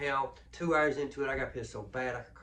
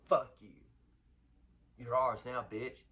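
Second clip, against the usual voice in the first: voicing the prison; 6 dB louder, slower than usual. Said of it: "that's what it's telling you".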